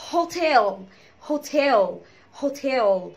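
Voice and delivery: "هوتيل" as ho-TEL, dark final L. Shown as a demonstration. Teacher drawing attention to L, "هوتيل" with dark L. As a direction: lean on second syllable of "هوتيل" with heavy L. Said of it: "خلي بالنا من ال L".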